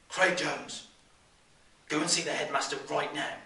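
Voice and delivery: loud, sharp teacher's reprimand; stern telling-off. Acted. Teacher sends bully to headmaster, stern loud telling-off. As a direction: call out the culprit loudly, then order him away.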